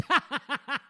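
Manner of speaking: burst of laughter. Real, not acted. Green screen glitch.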